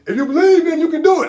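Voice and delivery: high, loud motivational yell; rousing shout. Acted. Uncle firing up nephew before the flip, shouting. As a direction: belt it out to fire him up.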